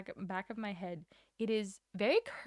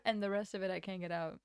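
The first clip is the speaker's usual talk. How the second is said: ordinary delivery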